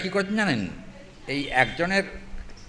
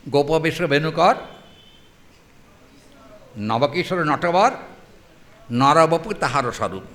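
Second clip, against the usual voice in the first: recited verse; 4 dB louder than usual. Acted. Scripture verse recited slowly.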